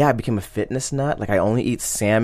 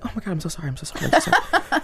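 girlfriend imitation; 7 semitones higher, faster than usual. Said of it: "She's like".

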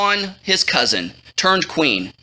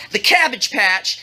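ordinary delivery

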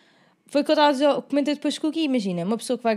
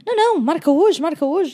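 insistent; 4 semitones higher, 6 dB louder than usual. Retold or imitated. Boyfriend insisting, voiced.

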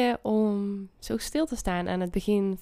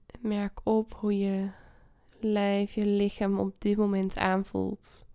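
guided meditation. Acted. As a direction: ease them into calm. Leads a meditation.

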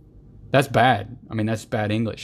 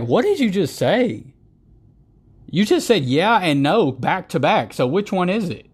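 incredulous mock retort; 8 semitones higher, 3 dB louder than usual. Mocking reply to a waffling answer, incredulous. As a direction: drag it out like you can't believe your ears. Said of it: "It's like".

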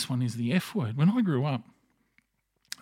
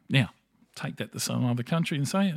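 normal talking voice

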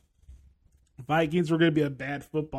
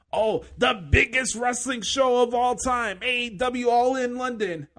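mock hype; 5 semitones higher, 4 dB louder than usual. Mocking wrestling media hype.

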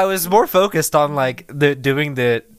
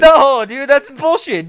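loud protest; 10 semitones higher, 7 dB louder, slower than usual. Real, not acted. Protesting loudly.

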